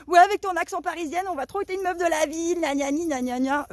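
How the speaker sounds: high, whiny mock of her critics; sneering playground-style mockery; mocking imitation. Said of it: "il y en a qui me disent".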